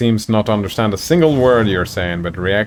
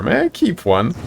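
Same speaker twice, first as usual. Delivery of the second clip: higher, eager plea; pleading ask; 9 semitones higher than usual. Acted. Octavia asks to keep a kobold, mildly eager high plea. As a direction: beg to keep a pet. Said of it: "Octavia exclaims".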